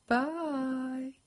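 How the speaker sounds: high, drawn-out little goodbye; smug cat. A narrator's reading. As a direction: sing it out high, teasing the loser. Smug cat waves at dog.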